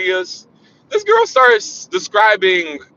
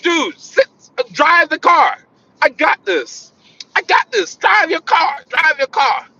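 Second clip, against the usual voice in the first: worked-up order; 8 semitones higher, slower than usual. Retold or imitated. Telling friend to drive.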